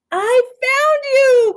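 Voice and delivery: high, loud, slow joyful cry; happy cry. Acted. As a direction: cry it out with a big grin. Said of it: "cried George with a big happy grin".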